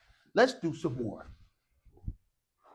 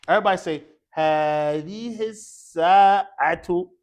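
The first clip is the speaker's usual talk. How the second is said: loud, slow model for repetition; stretched-out, emphatic classroom call; calling out a drill; 10 dB louder than usual. Shown as a demonstration. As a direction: project it slowly to the class.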